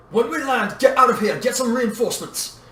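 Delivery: loud, high commanding voice; urgent order. A narrator's reading. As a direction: bark out orders in a crisis. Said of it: "Obi-Wan ordered".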